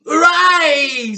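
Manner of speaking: high, loud triumphant shout; husband cheering grandma's disappearance. Acted. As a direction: shout hooray.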